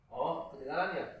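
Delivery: ordinary talk, nothing marked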